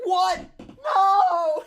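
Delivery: very high shocked shout; a kid shrieking in disbelief, loud and high; daughter's shout. Retold or imitated. Daughter shrieking at dad's news, very high and loud. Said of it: "She's like".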